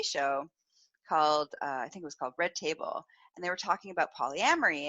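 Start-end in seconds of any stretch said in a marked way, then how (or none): none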